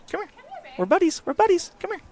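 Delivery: high cooing pet-talk voice. Real, not acted. Coaxing the dog mascot Luna in high pet talk. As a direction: coo it up high like talking to a puppy.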